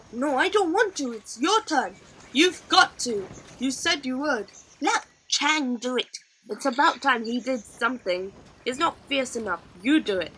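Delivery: squabbling rowers. A narrator's reading. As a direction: argue like nervous boys passing the job. Rowers squabble who tells her.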